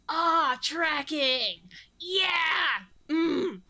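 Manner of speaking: loud happy shouts; excited cheer. Real, not acted. Host cheers as the VHS tracks, loud and happy. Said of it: "doesn't that feel good".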